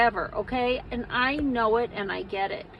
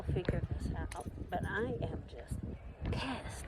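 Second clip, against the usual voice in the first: frustrated whisper; 4 semitones lower, 13 dB quieter than usual. Real, not acted. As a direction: breathe it out, fed up. Frustrated after hard class.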